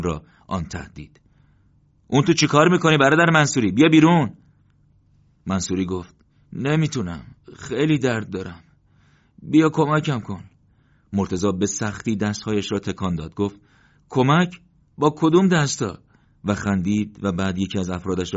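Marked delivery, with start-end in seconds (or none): 2.1-4.3 loud, much higher call down a hole. A narrator's reading. Calling down into the trench. 6.5-10.4 wounded soldier begging for help from the pit; in pain. A narrator's reading. 14.1-16.0 loud, higher ironic question; wry joke. A narrator's reading. Joking about his wounded hands.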